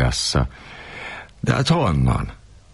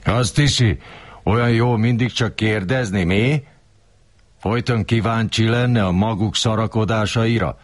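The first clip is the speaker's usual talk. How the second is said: higher, mild mock roar; mock yell; 7 semitones higher than usual. Kurilla roaring at the narrator, mild mock yell. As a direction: bawl at him without real volume. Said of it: "ordította".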